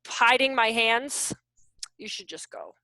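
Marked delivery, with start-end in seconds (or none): 2.0-2.7 hushed, just breath; whispered warning. Acted. Telling the room to walk away, whispered.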